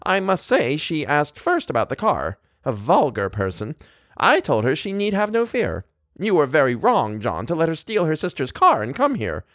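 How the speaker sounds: high, haughty disapproval; self-important. A narrator's reading. Wife blaming her husband over the car, self-important. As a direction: pronounce judgment as the one in charge. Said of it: "Missus Montague said importantly".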